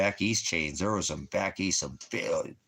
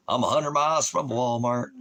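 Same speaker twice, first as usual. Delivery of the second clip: higher, louder jokey put-on voice; mock voice; 5 semitones higher, 4 dB louder than usual. Shown as a demonstration. Vlogger repeats his Walmart line in a higher put-on voice. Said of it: "repeated in a put-on voice".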